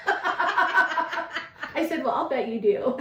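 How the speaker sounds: laughing through the line; amused retort, laughing. Retold or imitated. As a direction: chuckle out the wry reply. Laughing retelling joke.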